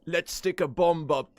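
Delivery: badly faked accent, played for laughs; bad Butcher accent. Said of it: "I can't really do it".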